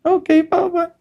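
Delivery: put-on very high child's voice; timid little boy. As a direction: squeak it out meekly. Boy answering father.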